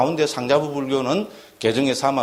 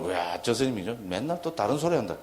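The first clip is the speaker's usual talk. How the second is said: softer, under-the-breath imitated complaint; mock grumbling; 2 semitones lower, 7 dB quieter than usual. Listeners complaining about the monk's repeated lecture, mock grumbling. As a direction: grumble quietly as a weary listener.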